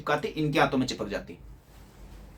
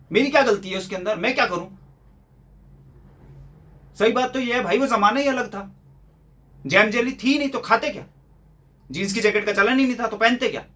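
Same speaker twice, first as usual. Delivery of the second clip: higher-pitched, exasperated comeback; exasperated retort; 5 semitones higher than usual. Acted. Son answering father's hardship lecture, exasperated protest.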